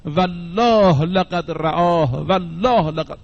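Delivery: slow, drawn-out solemn oath repeated three times. Retold or imitated. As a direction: swear it slowly and heavily, word by word. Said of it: "سه مرتبه امام صادق قسم خورد".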